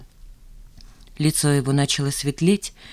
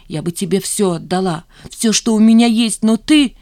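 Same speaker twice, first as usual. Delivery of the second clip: hurt reproach growing louder; holding back tears; 5 semitones higher, 6 dB louder than usual. A narrator's reading. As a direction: build hurt without breaking down. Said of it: "сумела сдержать слёзы". (сдерживая слёзы, с обидой)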